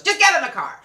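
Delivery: loud, high-pitched angry order; sharp shouted command, acted out; fed-up dad yelling. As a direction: yell it like an exasperated father. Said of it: "basically screaming and yelling at each other".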